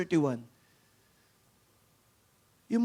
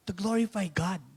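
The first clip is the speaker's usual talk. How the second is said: usual speaking voice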